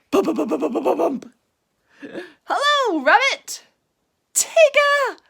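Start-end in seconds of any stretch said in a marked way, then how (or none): 2.4-3.6 high, loud cheery greeting; Tigger calling out. A narrator's reading. Tigger greeting Pooh and Piglet, loud cheery call. 4.3-5.2 high, loud startled name; surprised cry. A narrator's reading. Rabbit bumping into Tigger, high surprised cry. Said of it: "cried in surprise".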